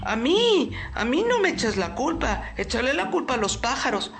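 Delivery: high, fast nervous fox. Acted. Fox defends himself.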